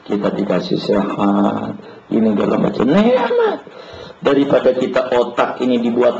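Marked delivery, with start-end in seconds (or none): 2.8-3.6 word jumps far up then falls away; savoured high. Acted. Preacher to congregation, lifting the word high and savouring it. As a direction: sing it out high with delight.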